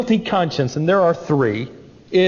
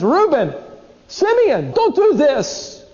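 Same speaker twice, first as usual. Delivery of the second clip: high, slow desperate plea; a chained boy begging his brothers; much higher, slow cry for help; pleading cry; 11 semitones higher, slower than usual. Retold or imitated. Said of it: "And he cries until his cry is no longer heard".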